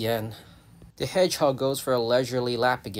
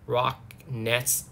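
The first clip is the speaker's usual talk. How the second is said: normal talking voice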